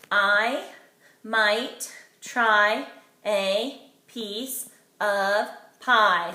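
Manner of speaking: I might try a piece of pie, each word set apart with pauses, slow at the end; counting each word. Shown as a demonstration. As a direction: count the words out one by one. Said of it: "Let's count how many words we had".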